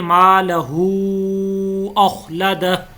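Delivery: मालहू with the हू held long, very slow; मालहू with a long drawn madd; lengthened madd. Shown as a demonstration. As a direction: stretch the हू of मालहू. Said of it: "तो इसको हम लंबा करके पढ़ेंगे".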